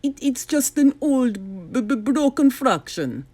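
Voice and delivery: stammering, flustered villain; sputtering. Acted. Doctor Brock caught lying, sputtering stammer. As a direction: stumble over the words, caught out. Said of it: "sputtered Doctor Brock".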